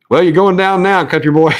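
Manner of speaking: high, jokey southern guy's tease; teasing stranger. Retold or imitated. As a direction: tease the little country boy. Guys tease kid on escalator.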